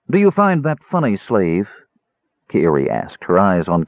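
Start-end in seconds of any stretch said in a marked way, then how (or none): 0.1-1.7 higher, biting challenge; cold challenge. Acted. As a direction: dare him to laugh at her. Kiiri challenges Caramon.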